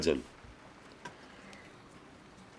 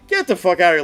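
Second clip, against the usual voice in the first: disbelief; 6 semitones higher, 5 dB louder than usual. Retold or imitated. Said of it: "I'm like".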